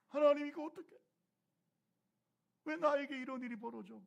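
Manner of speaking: loud, high anguished plea; a believer crying out to God in loud, high protest. Acted. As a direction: wail your complaint at God.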